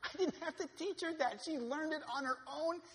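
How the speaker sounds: loud, high, impassioned sermon cry; preaching at full force. Acted.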